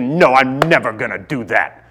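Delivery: lightly mimicked defiant little girl; mock stubborn refusal from a small child; digging in like a stubborn kid. Imitates his defiant young daughter.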